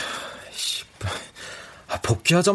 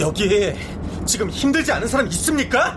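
heated, high-pitched argument; angry shouting; 10 semitones higher than usual. Acted. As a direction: snap back in anger. Acted quarrel in story.

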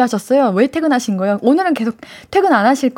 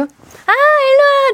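very high, slow, louder aegyo call; cutesy call to a listener wanting a hug; cute come here; 14 semitones higher, 4 dB louder, much slower than usual. Acted. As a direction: beckon adoringly for a hug. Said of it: "진짜 다 안아줄 수 있는데".

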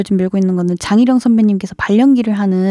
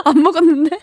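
laughing reply; 2 semitones higher than usual. Retold or imitated. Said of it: "하면 제가 항상".

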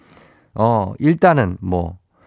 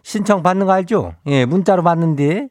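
Chungcheong dialect; 6 semitones higher than usual. Acted. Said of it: "행진리 이장인데요".

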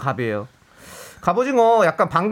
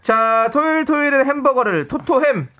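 excited announcer; 9 semitones higher, 3 dB louder than usual. Acted. Radio DJ shouting the segment name.

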